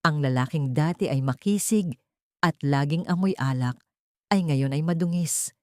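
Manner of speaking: ordinary talk, nothing marked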